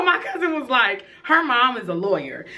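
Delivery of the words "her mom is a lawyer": usual speaking voice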